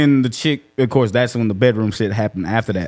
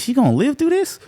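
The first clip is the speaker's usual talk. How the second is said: pitched-up mock concern; imitating a worried girl, high; 13 semitones higher than usual. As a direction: squeak the question nervously. Quoting worried friend.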